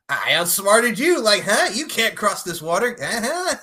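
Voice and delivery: high, crowing playful taunt; mock gloating. Retold hero taunts villain across water, mock gloating.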